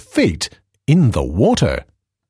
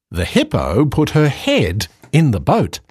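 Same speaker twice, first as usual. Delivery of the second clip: ordinary delivery, nothing marked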